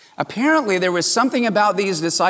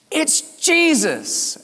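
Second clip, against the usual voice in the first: much higher, slow amazed cry; sudden recognition; 11 semitones higher, much slower than usual. Acted. Preacher voicing disciples recognizing Jesus, slow amazed high cry. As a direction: let the realization burst out high and drawn out.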